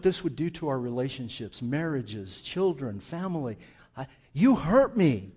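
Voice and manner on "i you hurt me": a loud, pained "you hurt me"; hurt outcry. Shown as a demonstration. Preacher acting out being hurt, loud pained cry. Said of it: "Instead of saying".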